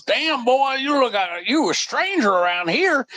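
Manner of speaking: high, put-on Texas drawl for a country guy; country Texas accent. Country guy questions the stranger in a high mock Texas accent. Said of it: "this country guy comes up and says".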